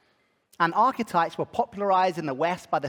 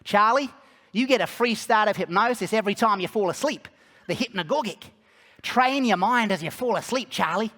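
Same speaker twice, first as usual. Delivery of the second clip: put-on Mervyn's Australian accent; 4 semitones higher than usual. Quoting the old Australian hypnotherapy teacher to the class, Australian accent. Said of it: "in his strong Australian accent".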